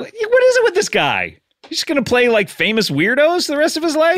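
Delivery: high-pitched comic disbelief; mock indignation. Host's mock outrage about actor's roles.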